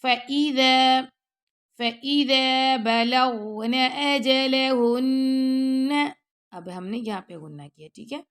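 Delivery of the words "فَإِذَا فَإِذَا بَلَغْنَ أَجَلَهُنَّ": slow, louder melodic tajweed chant; slow chanted recitation; Quran recitation. Shown as a demonstration. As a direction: draw each word out in full recitation. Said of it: "ای کر کے پڑھنا".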